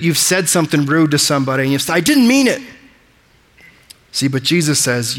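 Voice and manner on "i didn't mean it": high, louder, whiny protest; pleading it wasn't meant; defensive excuse. Pretend. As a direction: blurt it out like a caught kid. Voicing someone excusing rude words.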